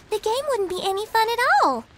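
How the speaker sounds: usual speaking voice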